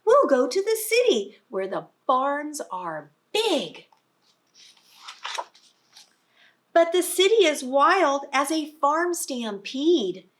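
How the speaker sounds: lively read-aloud voice; reading to children. Acted. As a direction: read it out big for a class of little ones. Reading picture book to children.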